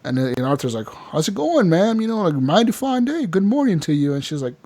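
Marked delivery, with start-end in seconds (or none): 1.1-4.1 high, chirpy mock pleasantries; put-on folksy hello, pitched up; imitated friendly greeting. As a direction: greet her cheerily in a raised voice. Arthur greets widow at her house.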